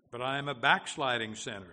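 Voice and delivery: loud, insistent objection; forceful protest; protesting sinner. Acted. Sinner's objection in quoted dialogue.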